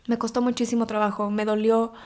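ordinary talk, nothing marked

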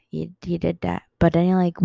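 normal talking voice

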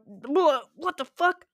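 slow, drawn-out baffled question; confused. Real, not acted. Losing her train of thought, slow and baffled. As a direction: stretch it out, baffled.

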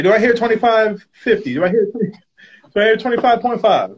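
high, loud auction patter; auctioneer. Pretend. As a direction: call bids like an auctioneer. Mock auction after naming price.

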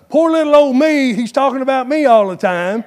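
a hint of poor-me churchgoer; self-pitying whine. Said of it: "graduate from the".

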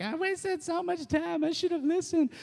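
high whining friend; mock whiny regret. Friend back months later, mock crying in a high whine. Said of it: "they come back to you crying".

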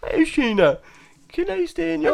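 high, whiny mock plea; mock pleading. Teasing her sister by mimicking her plea to Sheena, high mock whine.

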